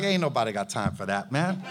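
very low, soft, quick brush-off; dismissive prophet. Retold or imitated. Prophet brushing off the proud general, deep and dismissive. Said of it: "And Elijah was like".

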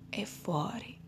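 breathy meditation whisper; soft spa whisper. Acted. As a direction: whisper it gently, like a spa guide. Meditation guide breathing cue.

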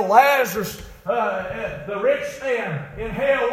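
high, loud revival preacher; impassioned preaching. Acted. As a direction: preach it urgently at full voice. Preaching at full force.

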